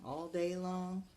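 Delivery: usual speaking voice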